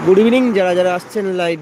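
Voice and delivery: loud, bright hello to viewers; loud greeting. Real, not acted. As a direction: call out the greeting. Greeting live viewers loudly.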